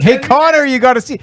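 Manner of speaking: high, eager voice of a pushy hockey veteran; Messier impression. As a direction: call out to Connor like an overfriendly old pro. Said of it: "And he's like".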